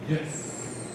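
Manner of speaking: a hint of stiff, hushed egghead talk; lightly mocking how smart people talk. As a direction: whisper it like a pompous scholar, laughing. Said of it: "I thought that's how they talk".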